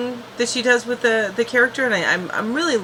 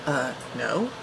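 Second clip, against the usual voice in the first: small, quiet, much softer reply; meek mumble; 6 semitones lower, 8 dB quieter than usual. Retold or imitated. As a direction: shrink away as you answer. Willow's meek answers to questioning.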